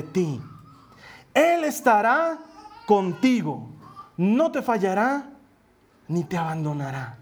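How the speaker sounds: slow, hushed, emotional reading of the verse; solemn scripture reading. Acted. Pastor reading Deuteronomy to the congregation, slow and moved. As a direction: read it slowly like a promise.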